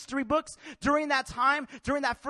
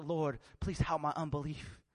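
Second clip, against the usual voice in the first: soft, lowered plea for help, pitch down; quiet plea; 8 semitones lower, 7 dB quieter than usual. Acted. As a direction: beg it under your breath. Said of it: "And he's like".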